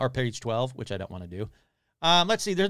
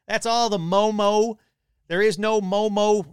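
loud, high, drawn-out sign-off on a held note; mock slogan; 8 semitones higher, 7 dB louder than usual. Podcaster trying out an episode sign-off, mock proclamation. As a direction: proclaim it like a catchphrase.

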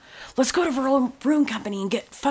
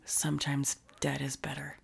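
low eerie horror-trailer whisper; creepy whisper; 6 semitones lower, 13 dB quieter than usual. Retold or imitated. Quoting creepy movie trailer.

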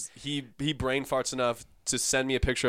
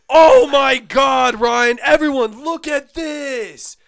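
shouted high and slow, calling the room over; mock loud exclaim; 14 semitones higher, 14 dB louder, slower than usual. Friend showing the photo around, a hammed-up loud call. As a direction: broadcast it to the whole room, hammed up.

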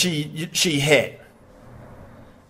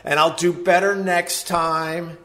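usual speaking voice

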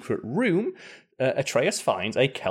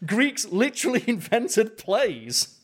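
laughing; 10 semitones higher, slower than usual. Real, not acted. Laughing at Kratos boasting of culture.

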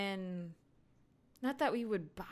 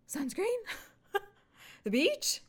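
high, questioning mock surprise; higher rising questions, amused; delighted recognition; 12 semitones higher than usual. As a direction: act out suddenly placing a scent. Acts out recognizing the scent.

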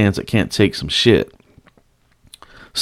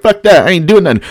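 defiant; 7 semitones higher, 4 dB louder than usual. Retold or imitated. Acted defiant reply to security.

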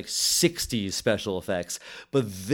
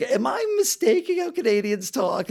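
much higher, a baffled inner voice; mock incredulity; 12 semitones higher, 3 dB louder than usual. Recalling his confusion watching the movie, high baffled self-question. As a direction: ask it as if doubting your own ears. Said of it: "I was like".

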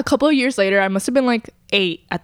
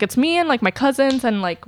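ordinary talk

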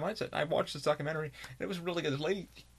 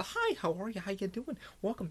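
higher, canned customer-service hello; scripted greeting; 6 semitones higher than usual. Retold or imitated. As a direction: rattle it off like a script at work. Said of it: "I don't do a script when I'm at work".

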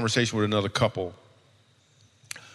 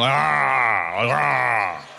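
lion roar. Pretend. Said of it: "Oh, roaring".

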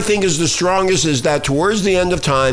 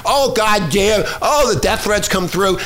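mock outrage; 6 semitones higher than usual. Mocking offended critics.

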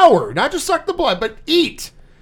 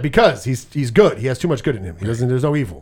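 lower-pitched, lightly put-on Jamaican vampire accent; mock Jamaican accent; 6 semitones lower than usual. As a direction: imitate a bad fake Jamaican accent, deep and lazy. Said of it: "terrible Jamaican accent the whole fucking movie".

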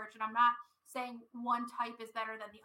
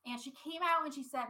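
ordinary delivery

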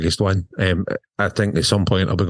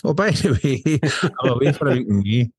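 casual sheepish announcement; 5 semitones higher than usual. Retold or imitated. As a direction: drop the news lightly, laughing. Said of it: "I told the wife".

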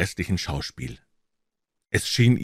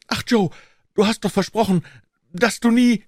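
very high, pleading wail; desperate cry; 14 semitones higher, 4 dB louder than usual. A narrator's reading. Accused man reproaching his accomplice Joe, high desperate cry. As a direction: call out to him in despair. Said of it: "und rief".

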